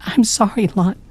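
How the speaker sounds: high, broken, stammering apology; weeping. A narrator's reading. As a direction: stammer through tears. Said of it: "she wept".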